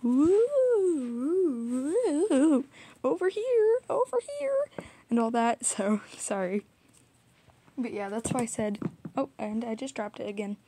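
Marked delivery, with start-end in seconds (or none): 0.0-4.9 high, drawn-out mock call; hammed-up calling from far off. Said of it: "I'm just like".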